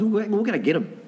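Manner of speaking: high, eager gift-shopping thought; light up at the idea of a present; a touch of giddy excitement; excited thought. Retold or imitated. Imagines buying gifts for kids.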